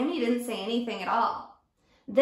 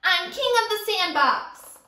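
higher and louder bratty boast; bully boasting; 6 semitones higher, 5 dB louder than usual. Acted. Teacher playing Sammy the bully, shouting his boast. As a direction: crow it like a sandbox king. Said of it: "Sammy yelled at him".

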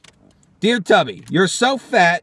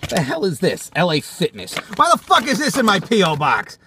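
ordinary delivery

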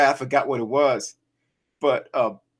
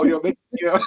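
ordinary talk, nothing marked

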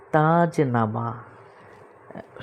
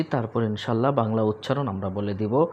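ordinary delivery

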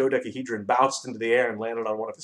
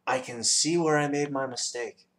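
usual speaking voice

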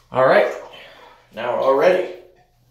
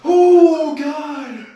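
gasping from cold; 8 semitones higher, 6 dB louder, much slower than usual. Real, not acted. Sitting down in ice.

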